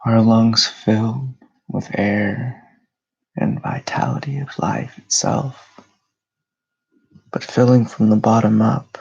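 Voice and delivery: unhurried meditation voice with long pauses; guided meditation. Real, not acted. Host leading listeners through breathing and grounding, meditative delivery. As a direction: guide them into calm, phrase by phrase.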